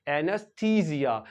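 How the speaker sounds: "एनेस्थीसिया" with long "थी" and Z for S; pronunciation demo. Shown as a demonstration. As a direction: model "एनेस्थीसिया" as a-nus-THEE-zia, falling at the end. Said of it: "इस तरह से ये pronounce करेंगे".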